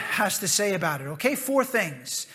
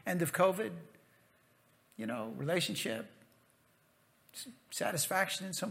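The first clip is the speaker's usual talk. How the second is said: ordinary talk, nothing marked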